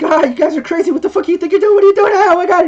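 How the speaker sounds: high, loud, frantic clown outburst; laughing clown. Acted. Grappled clown protesting to his captors, high hysterical shouting. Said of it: "he just starts laughing".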